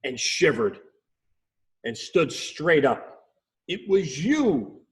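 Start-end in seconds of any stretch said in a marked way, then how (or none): 3.7-4.9 mild shout. Acted. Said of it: "Perry shouted".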